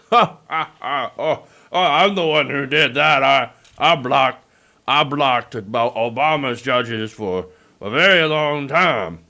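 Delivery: slow, gloating mock laugh and boast; gloating McConnell. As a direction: gloat over the old blockade, slowly. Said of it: "he's taking joy".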